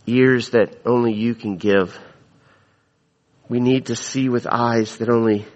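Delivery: low, measured pastoral prayer voice. Acted. Pastor praying over the congregation, low and measured.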